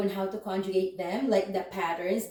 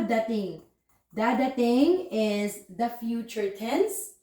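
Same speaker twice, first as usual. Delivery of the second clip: ordinary talk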